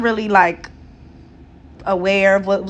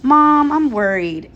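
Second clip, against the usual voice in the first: higher, slow, drawn-out "Mom"; imitated worried son; 5 semitones higher, 4 dB louder, slower than usual. Son telling his mom he's worried, mildly anxious. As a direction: lightly voice a worried child confiding. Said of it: "he basically came in my room and he was like".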